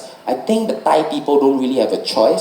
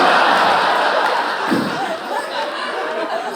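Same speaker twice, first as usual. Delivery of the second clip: high laugh; 4 dB quieter than usual. Real, not acted. Laughing at his confession.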